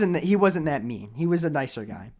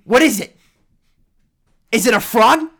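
loud, high, incredulous rant; exasperated sarcasm; 8 semitones higher, 8 dB louder than usual. Retold or imitated. Driver to frisking cop about his keys, exasperated sarcasm. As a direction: pile up absurd guesses at full volume.

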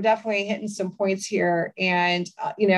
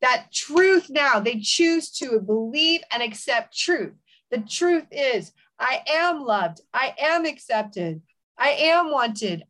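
fervent declaring prayer; 3 semitones higher than usual. Acted. Host praying over a suicidal listener, fervent declaration. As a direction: speak life over the listener.

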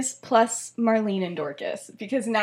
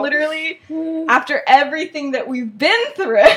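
mock-offended protest, loud and raised; 4 semitones higher, 4 dB louder than usual. A girl protests to her friend after being shut out, hammed-up offended. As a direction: act out an affronted friend, half laughing. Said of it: "She's, like, so offended".

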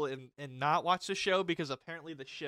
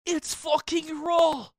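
much higher, louder, very slow; mock chef yell; 13 semitones higher, 8 dB louder, much slower than usual. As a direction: drag out the outrage like an angry chef. Quoting the famous chef rant.

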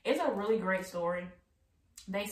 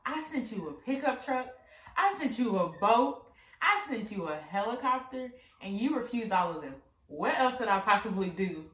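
exasperated God; 5 semitones higher, 5 dB louder than usual. A narrator's reading. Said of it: "God replied".

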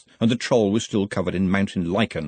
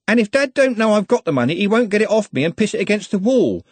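put-on high, louder, common-speaking girl; high-pitched, artless enthusiasm; lightly voiced blunt young woman; 11 semitones higher, 4 dB louder than usual. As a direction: finish the thought eagerly and bluntly. Voices Juliet in story.

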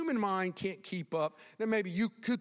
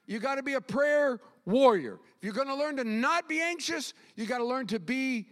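high, loud pulpit exhortation; emphatic preaching; 8 semitones higher, 6 dB louder than usual. Real, not acted. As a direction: drive it home to the congregation.

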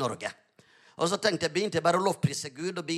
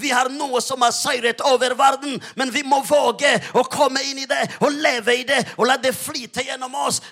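higher and louder, rousing the congregation; preaching at full force; 4 semitones higher, 4 dB louder than usual. Acted. Pastor rouses his congregation about faith, preaching hard. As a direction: drive the victory message home. Climax of sermon.

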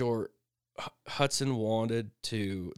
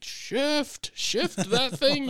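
much higher, loud urgent call; yelling shift; 14 semitones higher, 4 dB louder, much slower than usual. Retold or imitated. Dad calling after son riding off, high urgent yell. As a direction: holler at the kid to shift gears. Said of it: "I'm like".

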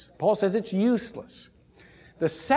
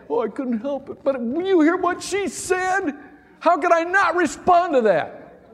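high, whiny mock excuse, louder at the end; 12 semitones higher, 4 dB louder than usual. Preacher mimicking a churchgoer's excuse, whiny and high.

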